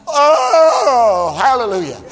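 loud crying Oh of sudden relief; half-sobbing hallelujah of relief; relieved cry. Retold or imitated. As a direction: cry out as the pain lifts. Relief after painkiller.

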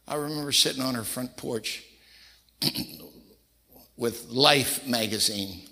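normal talking voice

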